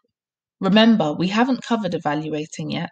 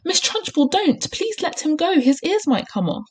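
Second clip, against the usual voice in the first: high-pitched, urgent plea; alarmed cry; 11 semitones higher than usual. A narrator's reading. Teacher begging headmistress to release a boy's ears, crying out. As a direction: cry out to stop her. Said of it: "Miss Honey cried out".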